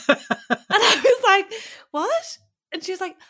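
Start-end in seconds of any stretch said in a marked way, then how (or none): 1.9-2.4 shocked what. Retold or imitated. Stunned reaction to pigeon shot.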